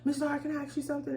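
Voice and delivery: put-on high, quick little kid voice; quick high child asking.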